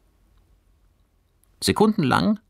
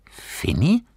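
puzzled; 12 semitones higher, 3 dB louder than usual. Acted. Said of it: "wiederholte Fabian ratlos".

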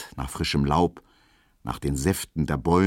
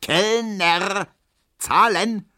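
high, louder call across the garden; calling the waiter; 12 semitones higher, 3 dB louder, much slower than usual. Acted. Herr Ziebland calls the waiter to pay, raised voice. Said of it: "ruft Herr Ziebland".

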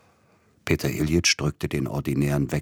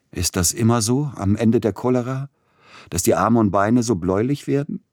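higher-pitched, uneasy questioning; anxious question; 6 semitones higher, 5 dB louder than usual. A narrator's reading. Dying patient questions his helpers, anxious and higher. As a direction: ask it anxiously, pitch lifting.

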